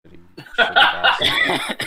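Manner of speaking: shrieking, laughing insult; high laughing squeal. Real, not acted. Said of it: "you're a terrible person".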